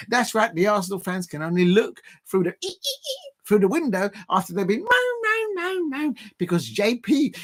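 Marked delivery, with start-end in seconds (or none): 2.7-3.3 high, soft squeaky noises; tiny high-pitched mock squeals; imitated squeak. Mimics squeaking at the window. 5.2-5.9 high-pitched imitated moans; repeated high mock wail; mock moaning. Mimics rival fans moaning.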